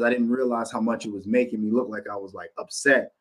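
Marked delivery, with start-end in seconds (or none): none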